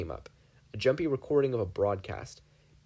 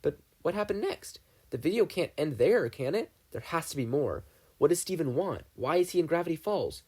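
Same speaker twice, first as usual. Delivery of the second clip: put-on higher, pressing boy voice; eager Dipper; 5 semitones higher than usual. Dipper presses Ford about the video, higher eager voice. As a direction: demand answers, anxious.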